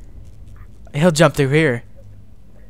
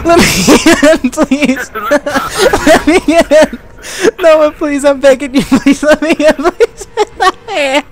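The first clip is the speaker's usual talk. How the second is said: loud, high laughing-crying begging; real fear, laughing and shrieking; hysterical pleading; 8 semitones higher, 9 dB louder than usual. Real, not acted. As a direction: beg desperately while laughing in panic. Begging to be let in.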